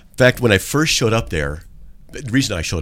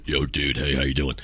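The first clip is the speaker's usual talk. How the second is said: mock dude voice; 7 semitones lower, 6 dB quieter than usual. Acting a casual guy caller.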